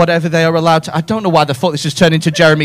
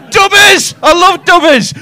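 high, loud, gleeful cry; delighted shout; 9 semitones higher, 6 dB louder than usual. Real, not acted. Comic relishing an audience member's nickname, gleeful shout.

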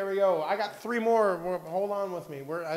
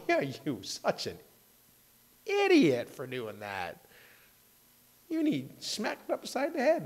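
self-scolding; slower than usual. Retold or imitated. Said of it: "after I'm going".

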